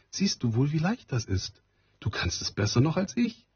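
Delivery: high, teasing fairy voice; playful fairy woman. Acted. The rain fairy praises Maren, high and playful. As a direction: coax the girl along, amused and high.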